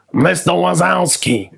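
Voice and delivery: high, slow, dry drone; Roz impression. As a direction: drawl it like the Monsters Inc clerk. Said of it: "that girl from Monsters, Inc".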